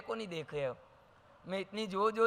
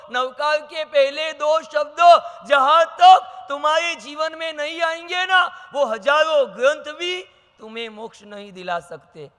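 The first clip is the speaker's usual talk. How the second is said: higher, louder impassioned sermon; fervent preaching; 5 semitones higher, 8 dB louder than usual. Real, not acted. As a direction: urge the crowd with rising fervour. Preaching at full force. (जोशीले प्रवचन का ऊँचा, तेज़ स्वर)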